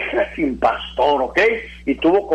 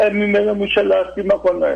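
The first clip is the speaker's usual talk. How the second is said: higher, sing-song imitation of useless pity; mock pity; 4 semitones higher than usual. Preacher mocks those who only feel sorry, mock pity. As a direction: parody someone who only feels sorry. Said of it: "no sirve para nada tu lástima".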